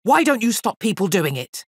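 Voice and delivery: high, scolding question; exasperated shout. A narrator's reading. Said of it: "shouted the elephant master".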